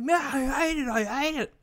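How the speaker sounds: high, whiny mock despair; a light impression of Phoebe hating her painting; imitating Phoebe. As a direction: whine it like a frustrated friend. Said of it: "Phoebe's like".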